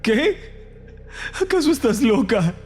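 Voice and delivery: high-pitched horrified outburst; shocked disbelief. Acted. Brother horrified by sister's plan, high shocked protest. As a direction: react aghast, pitch leaping up.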